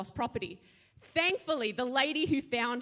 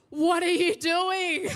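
laughing disbelief; 5 semitones higher, 5 dB louder, slower than usual. Retold or imitated. Shocked at husband following her.

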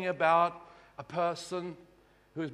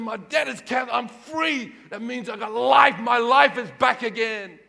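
joyful freed man; 6 semitones higher, 10 dB louder than usual. Retold or imitated.

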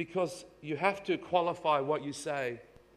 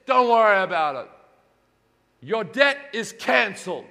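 king's pardon; 4 semitones higher, 11 dB louder than usual. Acted. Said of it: "He says".

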